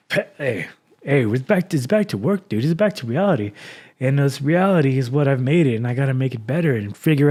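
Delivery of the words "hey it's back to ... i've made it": ordinary delivery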